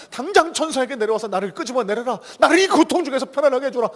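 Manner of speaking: 천사에게 명령하듯; commanding Jesus. Retold or imitated. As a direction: call the angels down with authority. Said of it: "하늘의 천군 천사를 부리실 수 있습니다".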